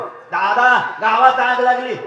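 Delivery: loud, high wailing news; son crying out. Acted. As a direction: cry out the fire to father. Eldest son cries out news. (मोठ्याने ओरडून सांगणारा मुलगा)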